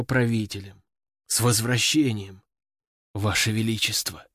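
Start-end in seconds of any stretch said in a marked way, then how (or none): 1.3-4.3 low, rasping and worn out; hoarse, exhausted greeting. A narrator's reading. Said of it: "охрипшим голосом".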